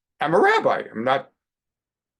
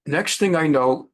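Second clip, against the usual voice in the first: ordinary delivery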